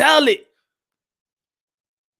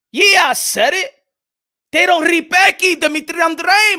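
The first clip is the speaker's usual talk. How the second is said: louder, higher, slow defiance; defiant; 5 semitones higher, 4 dB louder than usual. Real, not acted. Defending Andrade to his viewers, loud and defiant. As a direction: stand by it loudly.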